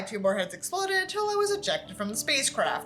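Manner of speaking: ordinary delivery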